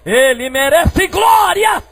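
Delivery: high, drawn-out shout of glory; shouted praise. Real, not acted. Preacher urging the church to give glory, loud high shout. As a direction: shout it slowly to the whole church. Repeats the praise much louder.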